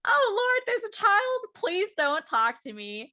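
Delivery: high, loud mock dread; mock panic. Cosplayer spotting a child, high mock panicked plea. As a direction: plead in playful alarm. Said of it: "I was like".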